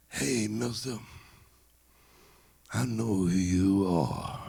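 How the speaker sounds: put-on slow old man's voice; old man's dawning recognition, drawn out. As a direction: say it slowly as an old man recognizing someone. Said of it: "the old man turned to Ali and said".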